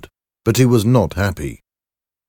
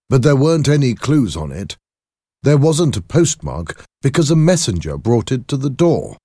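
higher, louder, insistent explanation; agitated doctor, explaining; 8 semitones higher, 5 dB louder than usual. Acted. Doctor explains the burned envelope to his lawyer, insistent. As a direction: explain emphatically in a raised voice. Voices Jekyll explaining the letter.

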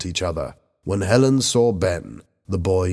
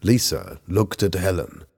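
plain reading voice

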